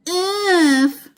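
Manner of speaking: high, startled "oof"; cried-out oof. A narrator's reading. Dusty Locks sinks into the feather bed, startled oof. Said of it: "cried Dusty Locks".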